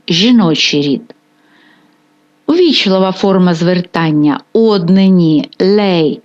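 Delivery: ordinary talk, nothing marked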